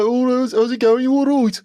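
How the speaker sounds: high, loud, hammed-up Brummie; broad Brummie accent. Shown as a demonstration. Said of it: "proper Brummie like that".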